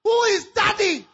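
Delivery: loud, high, wailing despair; anguished crying shout. Acted. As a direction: cry it out, heartbroken and loud. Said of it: "She cried".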